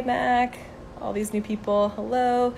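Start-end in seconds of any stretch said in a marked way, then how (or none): none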